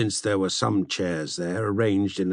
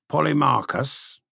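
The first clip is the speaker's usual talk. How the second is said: ordinary delivery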